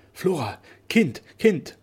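loud, high, drawn-out exultant calls; jubilant cry. A narrator's reading. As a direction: cry out in joy to a daughter. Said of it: "Mit diesem jauchzenden Aufrufe".

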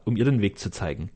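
ordinary delivery

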